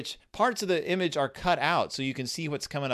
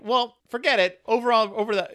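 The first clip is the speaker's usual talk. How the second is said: pitched up and louder, a put-on little bear voice; eager Sister Bear; 9 semitones higher, 5 dB louder than usual. Voicing Sister Bear hunting her trike, eager and high. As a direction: call it out as if you've just spotted it. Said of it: "Sister's like".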